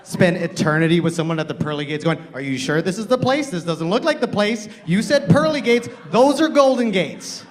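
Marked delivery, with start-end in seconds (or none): 2.3-7.4 high, loud nagging voice; nagging wife. Acted. Wife nagging husband at the pearly gates, shrill complaint. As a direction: scold him like a fed-up wife.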